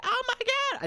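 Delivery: very high, loud screamed exclamation; acted scream. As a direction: play a passenger screaming in panic. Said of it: "she kind of does it as she screams".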